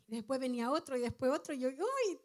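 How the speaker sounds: ordinary talk, nothing marked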